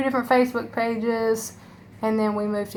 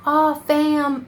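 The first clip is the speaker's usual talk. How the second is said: mocking quote; 7 semitones higher, 4 dB louder than usual. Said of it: "everybody says that".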